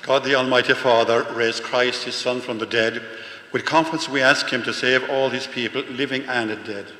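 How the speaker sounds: solemn public prayer at full volume; formal prayer. Real, not acted. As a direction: proclaim the prayer loudly to the whole congregation. Leads the congregation in prayer.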